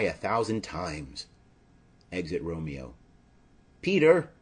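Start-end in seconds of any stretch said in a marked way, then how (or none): 3.8-4.3 loud, high call across the street. Acted. Nurse summons her servant Peter, loud high call. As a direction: shout for the servant to come.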